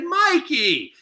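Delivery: put-on high, drawn-out hail to an old pal; delighted greeting. Star spots his old teammate in the crowd, delighted greeting. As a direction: imitate a star calling out to his buddy.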